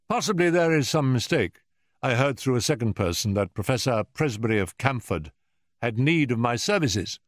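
unruffled detective, careful courtesy; courteous Holmes. A narrator's reading. Holmes tells Professor he heard through a second person, courteously.

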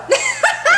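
high delighted cackle. Real, not acted. Comedian laughing at her own joke, cackle. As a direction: cackle at your own punchline.

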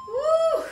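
high, loud cheerful whoop. Real, not acted. Coach whooping after the demo move, loud and high. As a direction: let out a big woo.

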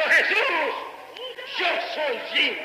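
high drawn-out acted cry; retold cry. Preacher voices Jim's third call at the altar, retold cry. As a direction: call out high, stretching each word. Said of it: "Y por tercera vez gritó".

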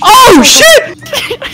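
high startled yell with a laugh; scared into laughing; scared horror game streamer. Real, not acted.

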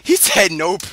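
shaky voice from adrenaline; trembling with post-fight adrenaline; adrenaline shakes. Real, not acted. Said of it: "I can't stop shaking right now".

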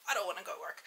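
half-whispered reluctant whine. Real, not acted. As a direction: whisper it like you dread it. Whining about going to work.

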